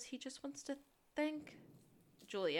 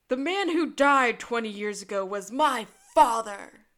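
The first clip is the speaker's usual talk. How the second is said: booming villain monologue, much louder; loud menacing killer reveal; big hammed-up horror villain voice; horror movie villain; 13 dB louder than usual. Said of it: "in horror movie fashion".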